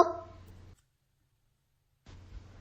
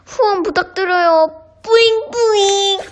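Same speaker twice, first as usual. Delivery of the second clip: breathy cutesy giggly; 7 semitones higher than usual. Acted. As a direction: beg sweetly like a cute child. Begging cutely for donations.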